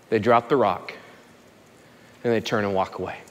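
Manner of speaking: low, soft sincere appeal; quiet earnest preaching. Acted. Preacher appealing to the recovery room, low and soft.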